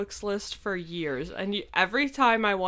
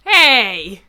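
loud; 5 semitones higher, 15 dB louder than usual. Real, not acted. Objects to Balto insult.

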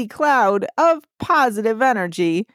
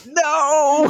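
high, drawn-out "no" of mock despair; hammed-up cry of dismay; 8 semitones higher than usual. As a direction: wail it playfully, pitched way up. Acts dismayed reaction to retrograde news.

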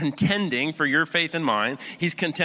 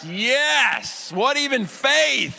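incredulous outburst; 12 semitones higher, 6 dB louder, much slower than usual. Retold or imitated. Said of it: "and I was like".

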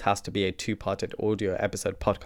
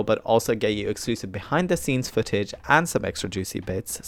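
ordinary talk, nothing marked

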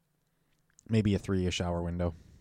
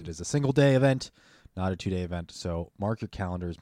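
ordinary talk, nothing marked

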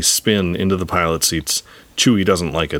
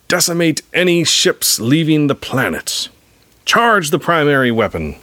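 villain's command; 8 semitones higher than usual. A narrator's reading. Hux commands an attack from bridge.

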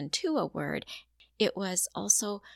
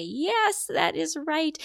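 high, bright cheer; excited praise; 9 semitones higher, 4 dB louder, slower than usual. Shown as a demonstration. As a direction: celebrate the attempt. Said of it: "we allow the joy and celebration".

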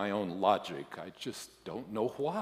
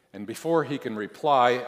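usual speaking voice

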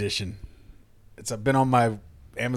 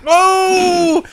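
high loud burst of laughter; laughing; 7 dB louder than usual. Real, not acted. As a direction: crack up loudly. Reacts to Emmerich joke.

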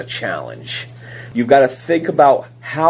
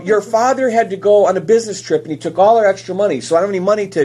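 voicing the mother; 7 semitones higher, faster than usual. Mother voiced in retold story.

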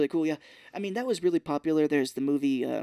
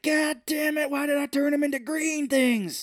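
much higher, cartoonish frustration; angry gnome king; 12 semitones higher than usual. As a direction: wail it like a sore villain. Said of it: "He's like".

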